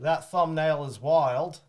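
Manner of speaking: loud, drawn-out mock Australian; Australian accent. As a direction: read it slowly in a broad Australian accent. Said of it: "I can do Australian".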